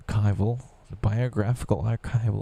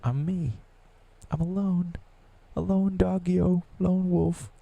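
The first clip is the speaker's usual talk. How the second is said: much higher sing-song self-pity; 10 semitones higher than usual. Pretend. Calling himself a lone wolf, high sing-song. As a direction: lilt it up high like a sad pup.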